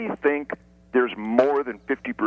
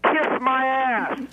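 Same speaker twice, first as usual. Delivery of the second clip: slow, drawn-out, much higher taunt; defiant taunt; 13 semitones higher, much slower than usual. Acted. Guest taunting the Mexican president, drawn out slowly and pitched high. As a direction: stretch out every word to mock him. Taunts Calderon across the border.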